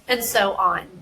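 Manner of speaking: ordinary talk, nothing marked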